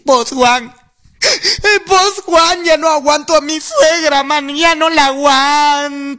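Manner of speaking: high, whiny, near-crying woman's voice; whiny female friend. Retold or imitated. Friend pleads to Juan about her mother-in-law, high whiny voice. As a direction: complain like a desperate wife. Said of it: "mi amiga viene y me dice".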